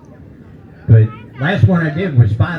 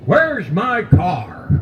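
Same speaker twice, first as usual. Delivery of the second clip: put-on slow, drawn-out lost old man; confused old man; 5 semitones higher, much slower than usual. Comic pretending to be old before five ladies, slow and drawn out. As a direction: stretch it out, lost in the parking lot. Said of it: "I'm walking out going".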